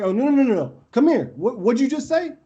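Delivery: high, rapid-repeat challenge; retold confrontation. Player calls back the kid who insulted him, acted-out confrontation. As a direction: refuse to let him back out.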